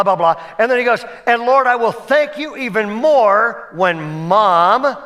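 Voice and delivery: lightly voiced kid saying grace. Kid's loud prayer in restaurant.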